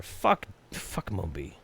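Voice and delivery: ordinary talk, nothing marked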